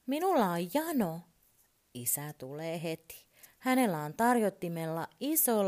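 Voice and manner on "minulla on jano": put-on high, loud little boy's demand; child calling out. A narrator's reading. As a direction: holler it to dad in the next room. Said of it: "hän huutaa".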